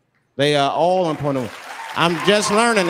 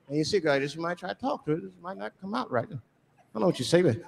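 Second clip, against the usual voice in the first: normal talking voice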